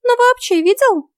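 very high, piping fairy voice; pushy little fairy; insistent fairy. A narrator's reading. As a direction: press for an answer in a tiny high voice. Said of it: "настойчиво вопрошала его фея".

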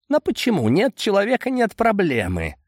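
high-pitched, insistent protest; protesting Boris. Acted. Boris defends his plan to Amadei, protesting high.

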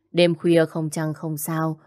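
plain reading voice

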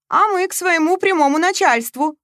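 high, loud flirty tease; provocative flirting. A narrator's reading. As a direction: flirt defiantly with your eyes. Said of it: "вызывающе играла глазками девица". (вызывающе, кокетливо, высоко и громко)

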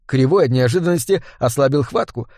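plain reading voice

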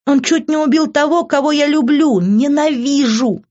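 high, loud, hurt and furious; anguished outburst. A narrator's reading. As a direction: cry it out, dragging the final word. Daughter's outburst before sobbing upstairs. (отчаянно и яростно, на высоких нотах)

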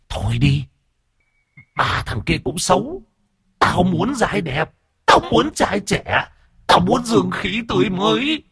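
high, eerie demanding voice; greedy ghost. A narrator's reading. Ghost girl rejecting the old men, high eerie demand. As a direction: glare and demand fresh blood. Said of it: "nó trợn mắt".

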